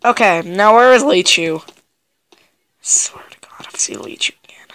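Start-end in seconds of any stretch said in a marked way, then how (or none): 2.8-4.7 hushed whisper, hiding. Real, not acted. Player hiding from the monster, whispering to himself. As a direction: breathe it out without voice.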